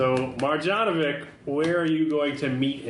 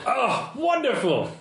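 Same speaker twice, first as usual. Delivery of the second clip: delighted; 10 semitones higher than usual. Acted. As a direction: swoop up and linger in delight. Contact delighted by promised fabric samples.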